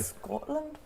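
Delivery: rough attempt at a Scottish accent; bad Scottish accent. Asking the co-host about Scotland workshops, bad Scottish accent. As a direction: try Scottish and get it a bit wrong. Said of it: "doing a really bad Scottish accent".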